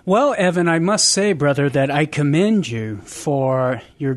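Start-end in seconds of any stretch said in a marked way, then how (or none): none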